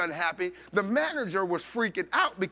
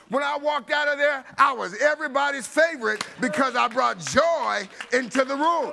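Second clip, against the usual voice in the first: louder, rising preacher's climax; full-force preaching; 4 semitones higher, 4 dB louder than usual. Acted. Preacher tells the congregation joy fills the room, preaching loudly.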